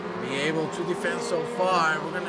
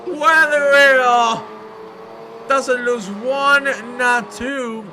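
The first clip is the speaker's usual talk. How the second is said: name stretched long, much higher and louder; excited drawn-out call; 5 semitones higher, 6 dB louder, much slower than usual. Real, not acted. Commentator calling the driver's off in a slow loud cry. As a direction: drag the name out in alarm.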